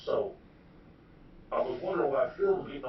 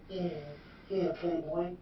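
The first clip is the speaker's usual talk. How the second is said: higher, airy put-on voice saying yeah yeah Playboy; imitating Phil's voice; 4 semitones higher, 6 dB quieter, slower than usual. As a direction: mimic his party-guy greeting lightly. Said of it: "This is his voice he used".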